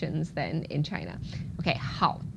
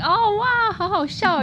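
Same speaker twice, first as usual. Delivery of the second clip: much higher and louder, delighted Oh wow; amused reaction; 13 semitones higher, 6 dB louder than usual. Retold or imitated. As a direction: burst out laughing at a joke, overjoyed. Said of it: "you're like".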